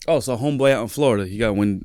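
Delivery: ordinary talk, nothing marked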